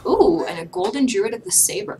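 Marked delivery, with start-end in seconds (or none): none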